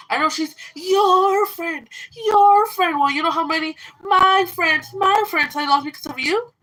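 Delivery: high, loud, furious outburst; real anger. Real, not acted. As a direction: yell your hurt at her. Angry rant at former friend.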